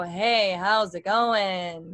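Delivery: drawn-out chest-resonant greeting, very slow. Shown as a demonstration. Teacher demonstrating chest vibration, slow chest voice. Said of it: "hand on their chest and go".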